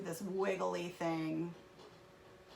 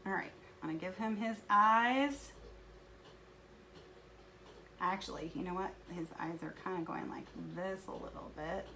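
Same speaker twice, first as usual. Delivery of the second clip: ordinary talk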